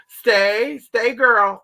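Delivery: high, loud stiff commands to a dog; mock stiff dog-training command; robotic command. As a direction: bark it out like a robot giving orders. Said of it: "like she's a robot or something".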